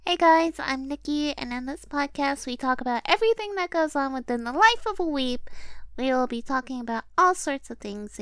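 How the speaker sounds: forced high voice. Pretend. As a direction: force a chirpy host voice. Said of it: "you're kind of forcing the voice".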